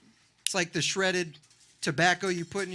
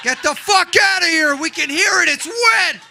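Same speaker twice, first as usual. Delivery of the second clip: high, loud, disgusted yelling; angry shouting; 8 semitones higher, 10 dB louder, slower than usual. Acted. Diners throwing out a public cougher, angry high shouting. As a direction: yell them out of the room in disgust.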